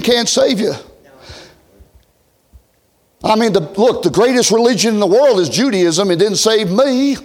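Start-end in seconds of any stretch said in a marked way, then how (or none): none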